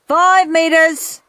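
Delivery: high, loud, slow coach's bellow. A narrator's reading. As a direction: announce the distance. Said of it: "bellowed Soggy Sid".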